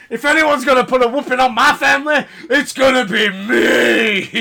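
hammed-up family threat; mock Vince threat. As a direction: shout it like a protective dad. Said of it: "It's like".

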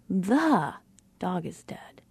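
"The" drawn out and set apart by a pause; stress on THE. Shown as a demonstration. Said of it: "depending on what word we are influencing".

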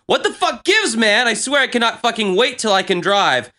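high-pitched exasperated reading; acted teen outburst; exasperated rant. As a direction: read it out like a fed-up teenager. Reading out old frustrated rant.

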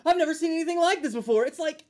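put-on high, amazed commercial kid; excited ad kid. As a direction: gush like a kid in a toy ad.

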